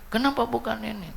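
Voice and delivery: high mock-grieving whine; imitated complaint. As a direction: lament it like the bereaved husband. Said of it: "Tuh, ngeluh".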